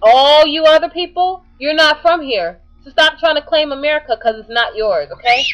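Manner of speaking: loud, high, forceful telling-off; real indignant scolding. Real, not acted. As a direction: tell them all off, insistently. Scolds those claiming America, worked up.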